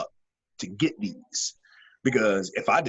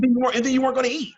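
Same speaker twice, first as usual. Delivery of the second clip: high-pitched amused warning; excited high retelling; 14 semitones higher, 5 dB louder, much faster than usual. Real, not acted. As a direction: pitch jumps way up, laughing at the thought. Laughing warning about mom's wrath.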